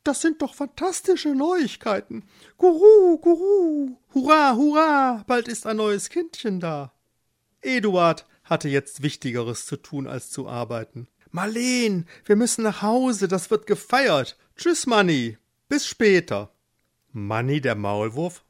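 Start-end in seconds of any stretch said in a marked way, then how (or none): 0.0-6.9 very high bird-like voice; cooing pigeons. Acted. Pigeons celebrating the baby news, cooing jubilantly. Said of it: "und die Tauben gurrten". 11.3-16.5 higher, eager rush; elated husband. Acted. Eduard takes his wife home to celebrate, excited.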